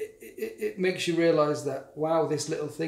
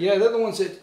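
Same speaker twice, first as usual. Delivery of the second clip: ordinary delivery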